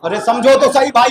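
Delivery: ordinary talk, nothing marked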